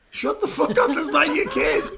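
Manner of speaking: loud, high laughing rant; mock yell. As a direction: yell it at him in amused disbelief. Mock yelling at Jim Carrey.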